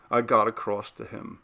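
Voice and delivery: usual speaking voice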